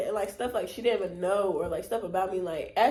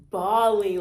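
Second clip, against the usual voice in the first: mock bawling; 8 dB louder than usual. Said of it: "I start".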